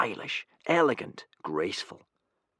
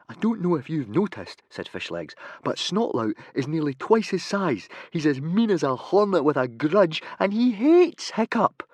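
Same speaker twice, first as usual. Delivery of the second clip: put-on higher anxious boy; lightly voiced Fishlegs; 9 semitones higher, 5 dB louder than usual. Fishlegs warning Humongous about Snotlout, worried. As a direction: warn him of the danger.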